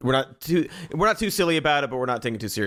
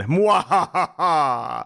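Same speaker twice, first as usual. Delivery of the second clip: slow hammy villain laugh; drawn-out mwahaha cackle; evil cackle; 4 semitones higher, 3 dB louder, much slower than usual. Pretend. Villain laugh after ransom joke.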